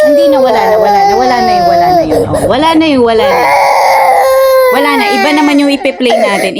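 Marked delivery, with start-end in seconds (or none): 0.1-5.0 high, tearful-sounding comfort. Real, not acted. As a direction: coo that it's all over now. Mother soothing crying baby.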